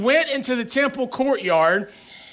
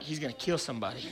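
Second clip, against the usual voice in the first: very soft worried under-breath line; muttered aside; 6 semitones lower, 13 dB quieter, much faster than usual. Retold or imitated. Youth counselor fearing the big kid, quiet worried mutter. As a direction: mutter it to yourself in alarm. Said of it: "And I'm like".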